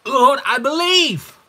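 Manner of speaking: drawn-out, high, loud cry of faith; acted crying out. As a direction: cry out to the Lord in a slow shout. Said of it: "cried out and said with tears".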